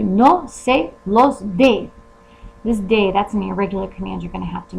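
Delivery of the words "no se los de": slow, louder model sentence. Shown as a demonstration. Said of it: "Magically turns into".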